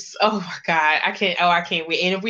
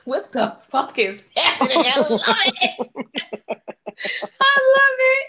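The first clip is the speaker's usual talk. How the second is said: laughing; 7 semitones higher, 3 dB louder, slower than usual. Real, not acted. Laughing quotes his line back.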